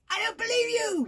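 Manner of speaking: high, loud "believe" yelled; yelling. A narrator's reading. Little girl yelling her disbelief at Hiccup. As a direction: yell "I don't believe you!" high. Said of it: "yelled the little girl".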